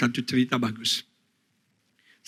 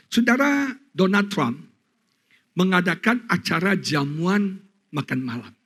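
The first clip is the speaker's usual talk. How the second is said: ordinary talk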